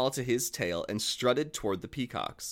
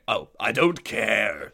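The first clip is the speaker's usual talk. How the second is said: snarling wolf; 2 semitones lower, 4 dB louder, slower than usual. A narrator's reading. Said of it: "snarled the wolf".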